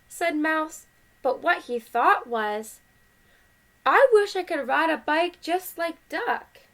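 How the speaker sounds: lively, measured picture-book reading; animated read-aloud with well-marked phrases; story read to children. A narrator's reading. Parent reading Duck on a Bike to kids, animated story voice.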